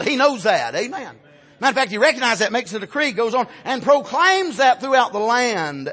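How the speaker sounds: loud, high, ringing sermon delivery; full-force preaching. Acted. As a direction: preach it hard to the whole church.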